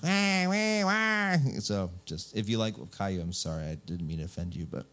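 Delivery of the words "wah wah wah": high, bratty "wah"; hammed-up toddler wailing; mock whining wail. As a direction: imitate a whining cartoon kid. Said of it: "That kid just whined like".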